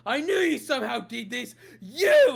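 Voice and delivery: high, loud furious shouting; angry guard. Acted. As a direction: accuse them with rage. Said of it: "he gets incredibly angry".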